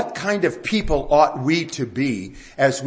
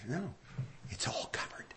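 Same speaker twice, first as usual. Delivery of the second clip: hushed, breathless whisper; 2 semitones higher, 20 dB quieter than usual. Retold or imitated. Mother recalling the bill being covered, whispered. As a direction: whisper it in awe. Retelling the dentist's reply.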